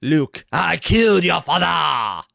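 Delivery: bad mock French accent, slow and loud; a clumsy French-accent take on Vader. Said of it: "this was done in a French accent".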